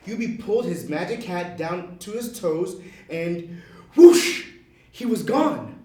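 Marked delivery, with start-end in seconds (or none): 4.0-4.5 breathy rushing whoosh; whoosh sound effect. A narrator's reading. Narrator voicing the elf vanishing with a whoosh. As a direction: blow out a magic vanishing swoosh.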